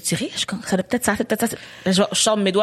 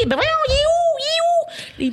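much higher, frantic squeaky panic; mock panic; slower than usual. Retelling her frantic search in bed, much higher panicky squeal. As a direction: squeal it like you can't find it anywhere. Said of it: "puis je suis comme dans le lit".

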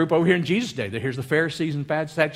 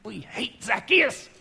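lightly acted crowd, hushed then rising; onlookers' reaction; 6 semitones lower, 6 dB quieter than usual. Onlookers react as Jesus calls the tax man, muttered dread. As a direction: start low and soft, draw it out rising. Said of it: "people were going".

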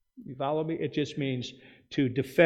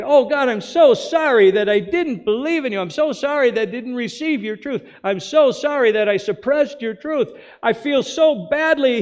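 high, loud, pleading apology; pleading sinner; 9 semitones higher, 8 dB louder than usual. Pretend. Sinners begging God to accept their apology, higher and pleading. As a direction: beg God for forgiveness in a higher, louder voice.

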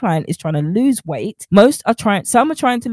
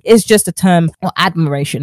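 normal talking voice

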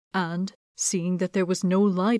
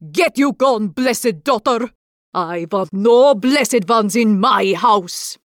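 loud, slow furious witch; angry shout; 2 semitones higher, 7 dB louder, slower than usual. Acted. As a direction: throw her out with a furious shout. Baba Yaga drives the girl out.

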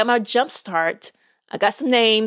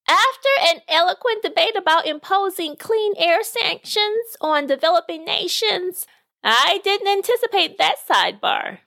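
put-on much higher, bemused old lady voice; higher-pitched retired knitter, amused; amused retiree; 8 semitones higher than usual. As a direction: chuckle over the sidebar like a tickled grandma.

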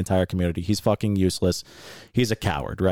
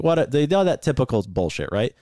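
ordinary delivery

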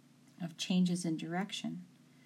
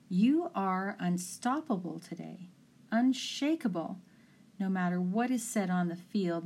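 ordinary delivery

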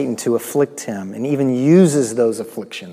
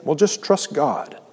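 mock pious; 3 semitones higher than usual. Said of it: "a pious coming across as faith".

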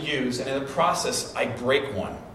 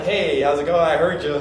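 playful, louder teasing neighbour; light, louder mock-friendly ribbing; joking, friendly; 8 dB louder than usual. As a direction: toss it off like a friendly joke. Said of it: "didn't say it in a funny type of way".

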